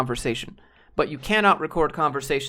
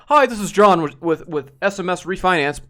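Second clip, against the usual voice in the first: mimicking salesman's greeting; 3 semitones higher, 6 dB louder than usual. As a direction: do the telemarketer's canned cheerful intro, pushed up and loud. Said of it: "He's like".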